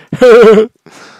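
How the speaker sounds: loud, high stoner laugh; Seth Rogen. Acted. Doing the laugh for partner, stoner laugh. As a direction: burst of imitated stoner chuckle. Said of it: "love that stoner laugh".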